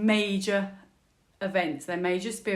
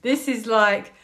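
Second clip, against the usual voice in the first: ordinary delivery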